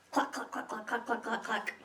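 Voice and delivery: quiet whispered hen clucks; soft clucking. Acted. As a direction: cluck softly like hens ignoring her. Chickens clucking under breath.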